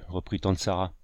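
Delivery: ordinary delivery, nothing marked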